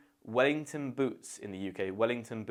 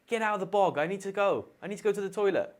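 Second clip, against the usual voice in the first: high-pitched, impatient call; mock-urgent call through a bathroom door; urgent demand; 8 semitones higher, 3 dB louder, faster than usual. Telling whoever's in the bog to get out, urgent and raised. Acted shout at family in the bog.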